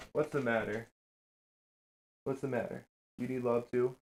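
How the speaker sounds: hushed, tender voice for the dogs; soft pet talk. Real, not acted. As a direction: coo gently at a needy puppy. Talking softly to his dogs.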